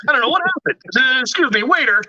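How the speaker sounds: high-pitched put-on complaint voice; mock outraged diner. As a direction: act out an indignant restaurant customer. Playing along as outraged diner.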